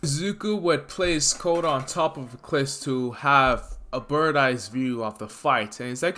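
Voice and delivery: ordinary delivery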